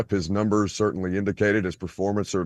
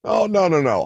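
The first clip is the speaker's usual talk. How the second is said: loud protest; 8 semitones higher, 5 dB louder than usual. Real, not acted. Host protests the fines.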